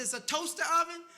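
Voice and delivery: high, loud hopeful question; hopeful guess. Retold or imitated. Pastor hopefully guessing again what's in the box, high and loud. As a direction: ask like you still hope it's good.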